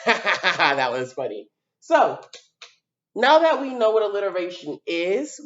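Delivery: ordinary talk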